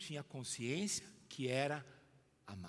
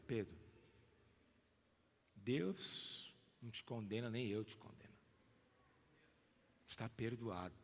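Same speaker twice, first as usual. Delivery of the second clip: tender; 6 semitones lower, 7 dB quieter than usual. Acted. Jesus comforting Pedro in sermon.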